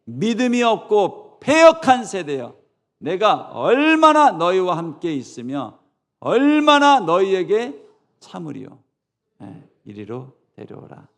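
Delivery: loud, high, slow rebuke read aloud; scolding reading. Shown as a demonstration. Pastor leading the congregation, loud scolding reading. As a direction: lead the reading in Jesus' anger. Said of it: "읽어보세요 시작".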